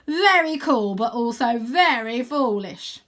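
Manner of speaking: high, loud teasing call; calling back. Acted. Wyn calling back to Arthur while running, teasing. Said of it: "he calls over his shoulder".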